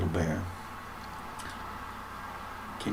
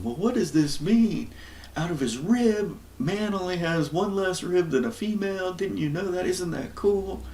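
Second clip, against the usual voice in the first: pitched way up, mocking know-it-all chatter; mocking the debaters; 10 semitones higher than usual. Teacher mocks people debating the rib, mocking high voice. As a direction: parody them in a high sing-song voice. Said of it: "all these people going back and forth on".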